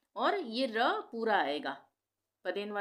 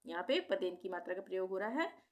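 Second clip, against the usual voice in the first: ordinary talk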